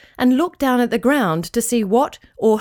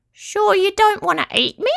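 high squeaky little creature; tiny high-pitched squeak; Petruchio; 11 semitones higher than usual. A narrator's reading. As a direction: squeak it as a small yabby. Shy yabby questions the dog.

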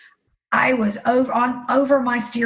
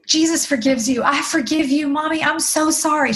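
higher, earnest daughter's voice; forgiving daughter; 6 semitones higher than usual. Retold or imitated. Daughter forgiving her mother, more concerned for her, earnest. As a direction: pour out forgiveness in a raised, girlish pitch. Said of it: "And she said".

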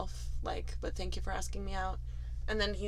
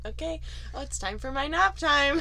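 put-on little kid voice; 7 semitones higher than usual. Quoting the toddler at nap.

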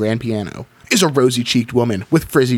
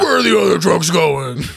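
bad cop; 5 semitones higher than usual. Bad cop interrogating Doctor Nair.

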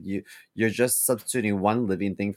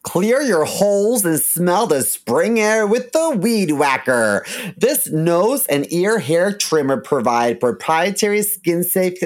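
upbeat sponsor ad read; 6 semitones higher, 6 dB louder than usual. Acted. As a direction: sell it like a radio spot. Reads a sponsor ad.

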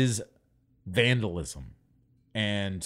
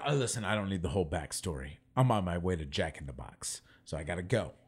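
quick and softer, cutting her off; fast dismissive brush-off; 8 semitones lower, 7 dB quieter than usual. Retold or imitated. Man cutting off the homeless lady's backstory, fast and much softer. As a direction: rush past her story, much softer.

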